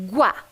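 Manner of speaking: "Guá" set apart as one full syllable, gu gliding into á; demonstrating the sound. Shown as a demonstration. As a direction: present Guá alone, very clearly. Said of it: "o som de guá". (som de "Guá" isolado, bem articulado)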